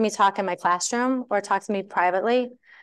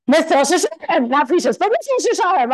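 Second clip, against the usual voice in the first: retelling his eager burst, higher and louder; imitating an excited husband; 5 semitones higher, 4 dB louder than usual. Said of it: "he's all excited".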